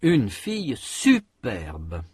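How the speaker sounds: slow, SU of superbe hit harder; emphatic stress. Shown as a demonstration. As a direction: insist on the first syllable of superbe. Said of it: "observez l'expression suivante".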